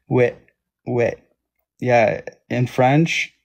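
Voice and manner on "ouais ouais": "ouais" said as a short open "weh"; Québécois ouais. Shown as a demonstration. Streamer showing viewers how Québécois say yes, Québécois "ouais". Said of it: "ils parlent comme ça".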